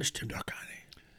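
whispered aside; breathy, unvoiced protest; whispering. Real, not acted.

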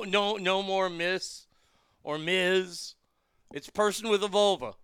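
ordinary talk, nothing marked

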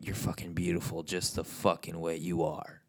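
ordinary talk

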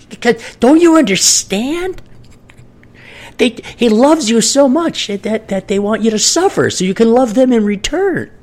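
sweetly condescending churchy explanation; a preacher patiently mocking a child. Mocking religious reasoning continued.

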